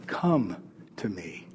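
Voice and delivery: low, hushed divine call; soft, low beckoning, with a pause mid-phrase; gentle invitation. Acted. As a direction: invite them in very quietly. Voicing Jesus calling the listeners.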